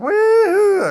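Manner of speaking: high, loud rodeo whoop. Retold or imitated. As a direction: yell it like riding a bucking horse. Horse bucking wildly across field.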